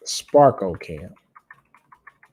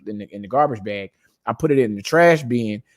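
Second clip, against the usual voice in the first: normal talking voice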